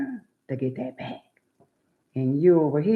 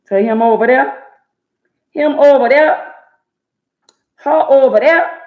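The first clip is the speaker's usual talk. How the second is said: loud, higher call-outs; real loud higher call to people watching; 9 semitones higher, 13 dB louder than usual. Real, not acted. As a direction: call them out loud, pointing each one out. Calling out people in the chat.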